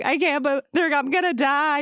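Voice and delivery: put-on a three-year-old sure she will die; scared little child. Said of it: "I'm three and I'm tapping".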